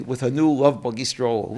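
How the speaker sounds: ordinary delivery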